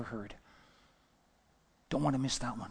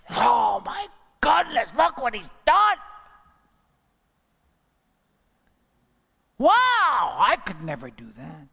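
amazed enemy; 7 semitones higher, 6 dB louder than usual. Acted. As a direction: gasp in awe at the deed.

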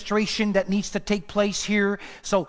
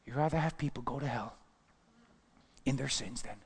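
hushed retort; 7 semitones lower, 10 dB quieter than usual. Acted. Quiet sarcastic challenge.

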